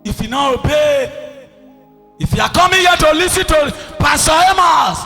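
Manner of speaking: usual speaking voice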